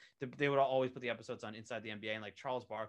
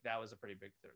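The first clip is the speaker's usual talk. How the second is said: usual speaking voice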